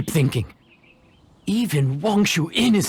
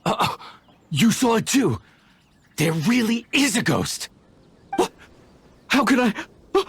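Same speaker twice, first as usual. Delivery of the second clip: scared; 9 semitones higher than usual. Acted. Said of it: "Scared of something so insignificant".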